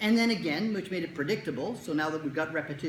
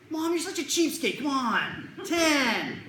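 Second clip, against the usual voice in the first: put-on haggling kid; 9 semitones higher, 5 dB louder, much slower than usual.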